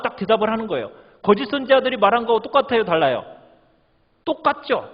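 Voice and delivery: normal talking voice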